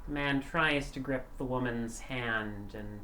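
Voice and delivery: eerie narration. A narrator's reading. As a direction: set a spooky scene softly. Game master's scene setting.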